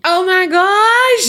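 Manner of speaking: loud, drawn-out high cry; slow, high gasp-like exclamation; shocked exclamation. Real, not acted. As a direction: stretch it out in loud disbelief. Reacts to the cliffhanger.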